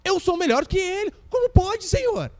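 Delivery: indignant, proud protest to God; arrogant complaint. Acted. Adonias protesting to God that he is better, arrogant. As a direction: puff up and demand why not you. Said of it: "Adonias se achava melhor que seu irmão".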